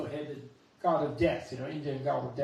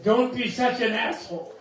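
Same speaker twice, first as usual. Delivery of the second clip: stern statue Jesus; 6 semitones higher, 7 dB louder than usual. Retold or imitated. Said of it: "Jesus did, and said".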